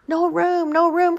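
high, loud crowd cry. A narrator's reading. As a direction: shout it out in protest. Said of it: "cried the animals".

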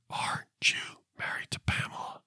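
breathy hushed whisper, imitating the ghost voice; eerie whispered ghost voice. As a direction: whisper it like a faint spirit recording. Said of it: "So you hear".

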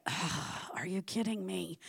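mock disgust muttered under the breath; exasperated groan. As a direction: roll your eyes with the voice, barely audible. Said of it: "I'm just like".

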